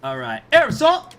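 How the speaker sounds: loud shout. Real, not acted. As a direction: shout it out excitedly. Hyped call to start.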